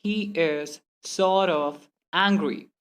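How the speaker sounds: very slow, a gap between words. Shown as a demonstration. Teacher showing the slow way to avoid, word-by-word. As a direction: pull "sort" and "of" apart. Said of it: "Instead of saying".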